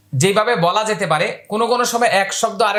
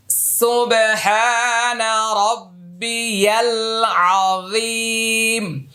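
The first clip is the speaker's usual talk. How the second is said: slow chanted tasbih, vowels held long; slow Quranic recitation; 4 semitones higher, much slower than usual. Shown as a demonstration. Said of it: "তিন আলিফ লম্বা করতে হবে". (ধীরে টেনে তাসবিহ পাঠ)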